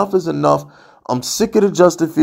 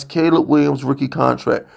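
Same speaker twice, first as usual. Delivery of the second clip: ordinary talk, nothing marked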